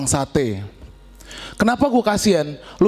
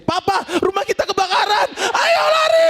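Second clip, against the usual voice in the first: panicked wife scream; 11 semitones higher than usual. Retold or imitated. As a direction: shriek that the house is burning. Wife panics over fire.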